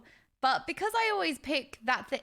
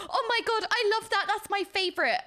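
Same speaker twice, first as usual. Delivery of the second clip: gushing; 9 semitones higher than usual. Retold or imitated. Streamer imitating herself gushing over something she loves. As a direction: squeal it like you adore it. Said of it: "I'm like".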